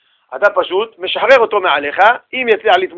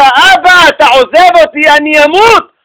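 much higher and louder, frantic; desperate scream; 8 semitones higher, 8 dB louder than usual. Retold or imitated. Daughter screaming to her father that she will die. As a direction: cry out as if being let go in the water. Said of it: "בגלל שהיא צעקה".

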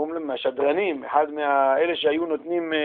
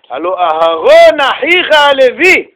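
slow, higher, louder reading; verse read; 7 semitones higher, 8 dB louder, much slower than usual. Acted. Preacher chanting the verse to the congregation slowly. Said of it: "reading the verse".

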